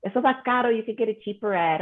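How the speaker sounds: imitating her mother. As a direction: playfully mimic a mom warning it's too expensive. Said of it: "She goes".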